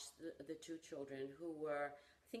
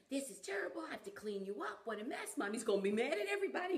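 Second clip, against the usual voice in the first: higher and louder, rushed exasperated scolding; an imitated fed-up father over the mess; mock angry dad; 9 semitones higher, 8 dB louder than usual. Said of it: "And he said".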